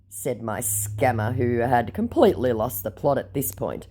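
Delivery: normal talking voice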